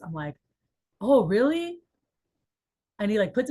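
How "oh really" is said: high, loud oh really; surprised. Retold or imitated. Manager learning rep's secret, surprised. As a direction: genuine surprise.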